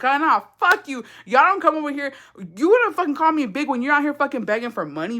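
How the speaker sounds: high-pitched, indignant rant; retold angry tirade. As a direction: replay telling them off from the truck. Retells yelling from truck during chase.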